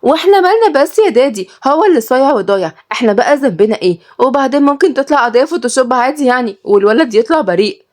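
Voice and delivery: put-on high, annoyed young woman's voice; spoiled daughter whining back at her father. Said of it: "ردت ليلى بضيق". (صوت بنت متضايقة بنبرة عالية)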